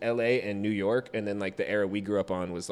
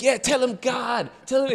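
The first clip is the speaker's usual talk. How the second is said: egging-on producer; 6 dB louder than usual. Retold or imitated. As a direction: push him from behind, cheering.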